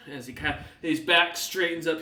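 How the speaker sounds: ordinary delivery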